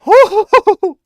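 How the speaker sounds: a sudden high, loud laugh; cracking up hard at the sound; loud burst of laughter. Real, not acted. Streamer watching a clip, bursting into loud high laughter. Reacting to bass-boosted video sound.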